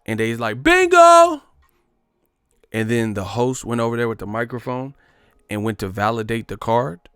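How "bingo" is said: much higher, loud triumphant cry; a player yelling bingo in triumph; mock excited bingo shout. Player yells false bingo.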